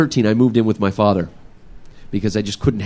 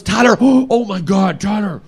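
high, panicked gasping over the name; startled, catching breath in alarm; 8 semitones higher, slower than usual. Retold or imitated. Teen spots brother's rash, alarmed gasp.

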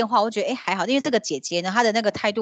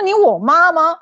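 indignant retort; 6 semitones higher, 8 dB louder, slower than usual. Retold or imitated. Snapping back at pushy matchmaker.

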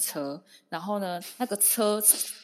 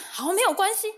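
high, loud hammed-up "no problem"; cool reassurance; 12 semitones higher, 6 dB louder than usual. Imitating the driver calmly taking charge, in a high loud voice. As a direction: play the driver's breezy confidence big. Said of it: "然后他就说".

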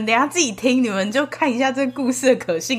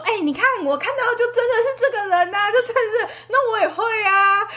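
high mocking laughing voice; mock the claim while laughing; laughing mockery; 11 semitones higher than usual. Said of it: "他就，他就随便就说". (笑着模仿嘲讽)

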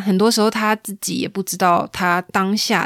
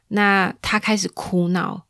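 ordinary delivery

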